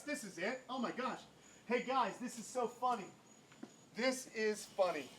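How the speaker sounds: distant voice inside the enclosure; far from mic. Real, not acted. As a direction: call out from across the room. Searching for turtle inside enclosure.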